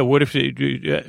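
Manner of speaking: usual speaking voice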